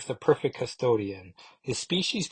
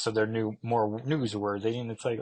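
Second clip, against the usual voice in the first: usual speaking voice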